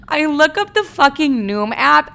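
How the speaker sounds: high, slow, incredulous telling; exasperated disbelief. Real, not acted. Telling viewers about the Noom app, exasperated.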